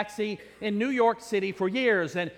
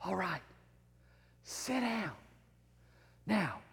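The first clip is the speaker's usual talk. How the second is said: quiet; 12 dB quieter, slower than usual. Acted. Preacher keeps congregation quiet before shout.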